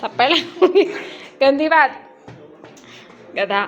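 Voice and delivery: mock scolding. Mother scolding small child.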